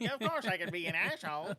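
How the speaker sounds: quick, put-on actor voice breaking into a laugh; mock cocky actor. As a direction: rattle it off in a silly put-on voice. Voices French Stewart's eager answer.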